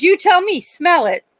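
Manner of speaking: high, loud, pushy imitation; insistent nurse, pitch jumping up; real attitude. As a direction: throw the challenge back with attitude. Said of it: "had a real attitude".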